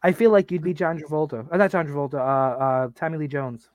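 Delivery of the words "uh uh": normal talking voice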